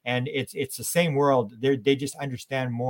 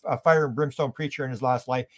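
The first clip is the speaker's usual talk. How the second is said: normal talking voice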